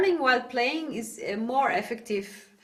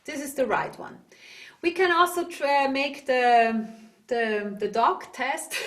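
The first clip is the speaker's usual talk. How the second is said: ordinary delivery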